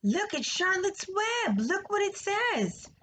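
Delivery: high, drawn-out excited exclamation; excited cry. A narrator's reading. Fern shows the family Charlotte's web, crying out excitedly. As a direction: exclaim high and slowly, urging everyone to see. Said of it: "cried Fern".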